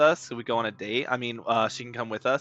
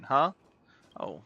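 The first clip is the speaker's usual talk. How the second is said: ordinary talk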